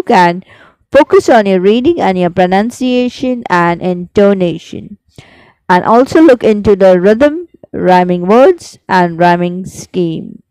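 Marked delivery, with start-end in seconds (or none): none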